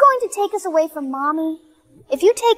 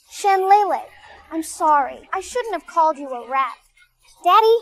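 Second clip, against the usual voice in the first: normal talking voice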